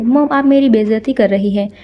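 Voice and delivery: ordinary delivery, nothing marked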